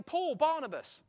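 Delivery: loud, high call across the hall. Acted. Council elders calling Paul and Barnabas forward, loud. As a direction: summon them by name.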